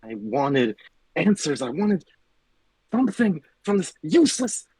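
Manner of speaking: hushed furious yelling; whisper yelling. Acted. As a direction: scream under your breath. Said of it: "your whisper screaming is not picking up on the mic".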